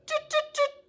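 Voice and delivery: very high, squeaky little tuts; monkey chatter. A narrator's reading. Monkeys shaking fingers back at the peddler, high chattering. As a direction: mimic cheeky monkeys, tiny and high. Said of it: "monkeys only stamped their feet back".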